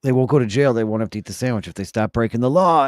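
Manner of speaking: ordinary talk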